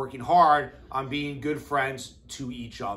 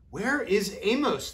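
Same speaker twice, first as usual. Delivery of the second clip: worried wondering; 7 semitones higher, slower than usual. Acted. Animals wonder where friend is.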